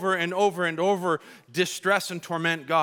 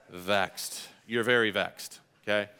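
vexed; 8 semitones lower, 3 dB quieter than usual. Pretend. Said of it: "Say it with me".

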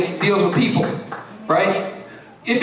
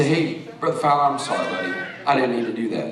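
apology; 11 semitones lower, 6 dB quieter, faster than usual. Retold or imitated. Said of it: "and say".

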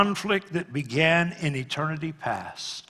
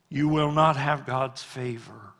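normal talking voice

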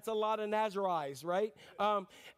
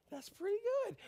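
much higher, tickled reply; pleased mock reply; 10 semitones higher than usual. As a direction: say it pleasantly surprised. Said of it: "I'd be like".

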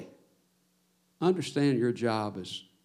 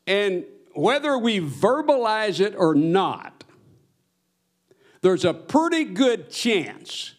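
preaching build; 6 semitones higher, 3 dB louder, slower than usual. Acted.

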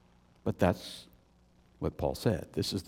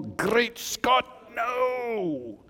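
much higher, beside himself; Paul beside himself at the thought; emotional outburst; 11 semitones higher, much slower than usual. Retold or imitated. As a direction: cry it out in alarm. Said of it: "emphatic, emotional".

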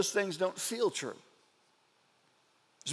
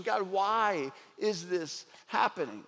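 softer, lower and slow, almost to himself; hushed questioning; 4 semitones lower, 5 dB quieter than usual. Retold or imitated. Preacher voicing Lamentations' question to God, hushed and slow. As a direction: let the question sink, quiet and heavy. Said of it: "The entire book is questioning".

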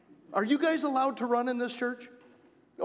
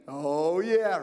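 usual speaking voice

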